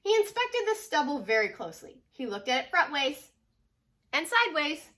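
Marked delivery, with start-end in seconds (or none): none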